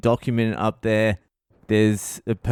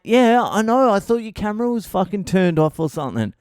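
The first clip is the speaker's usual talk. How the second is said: high excuse; 9 semitones higher, 3 dB louder than usual. Retold or imitated. Husband pleading excuse.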